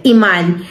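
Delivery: Iman said ee-MAN, stress on the last syllable; Spanish accent on Iman. Shown as a demonstration. Teacher giving the Spanish reading of a student's name, accented. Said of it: "with accent".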